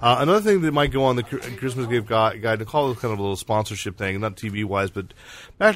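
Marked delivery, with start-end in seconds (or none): none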